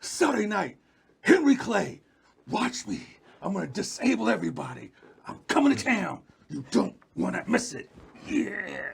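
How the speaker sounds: punchy phrases with big pitch swings; swaggering wrestling promo. Shown as a demonstration. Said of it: "watch some Ric Flair. Get the swag going".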